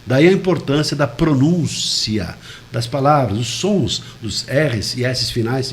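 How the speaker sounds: slow, over-articulated "palavras" and final "S's"; deliberate slow pronunciation of "pronúncia das palavras"; exaggerated diction. Shown as a demonstration. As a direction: spell out every sound of "R's e S's finais". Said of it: "estou exagerando".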